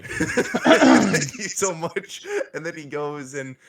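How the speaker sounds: high, loud, slow through laughter; laughing. Real, not acted. Game master thanks the player, laughing loudly.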